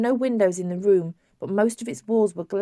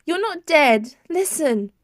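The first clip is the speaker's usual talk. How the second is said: upset pleading; 8 semitones higher, 5 dB louder, slower than usual. A narrator's reading.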